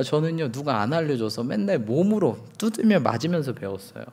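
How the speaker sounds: usual speaking voice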